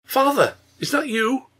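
put-on high-pitched, wondering little-girl cry; astonished child. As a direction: call out to father in amazement. Child calls out to her father.

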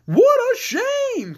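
very high, drawn-out exclamation; slow, swooping Scarecrow lament; crying out as Scarecrow. Acted. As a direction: cry it out high and long. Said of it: "cried the Scarecrow".

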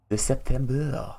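put-on French accent. Streamer showing off to chat, exaggerated French accent. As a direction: stretch it out in playful mock French. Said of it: "I can do it with a French accent".

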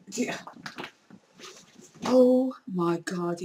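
normal talking voice

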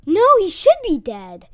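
loud, high outraged protest; indignant shout at the game; frustrated yell. Real, not acted. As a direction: yell it at the screen in disbelief. Frustrated gamer yelling at screen.

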